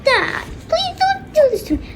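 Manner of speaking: very high wailing whine; hammed-up crying child; tearful plea. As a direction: beg through put-on tears. Daughter crying at her dad.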